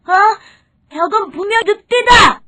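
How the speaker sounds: high, loud cry of recognition; startled forest animal exposing the wolf; shocked discovery. Acted. Animals discover their king's deception.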